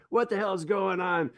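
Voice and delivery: high, hammed-up angry director; retelling a movie director yelling at the singers; mock yelling director. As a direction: bark at the choir like a fed-up director. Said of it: "the director's yelling at him, like".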